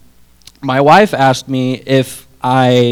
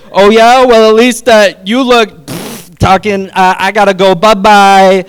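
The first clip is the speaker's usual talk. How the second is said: squeaky, much higher and louder, falling apart mid-sentence; flustered retort; 6 semitones higher, 7 dB louder than usual. Retold or imitated. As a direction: sputter a comeback and flee. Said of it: "I just went".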